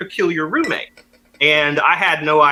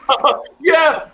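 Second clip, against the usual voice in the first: laughing; 10 semitones higher than usual. Retold or imitated. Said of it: "he just bursts out laughing".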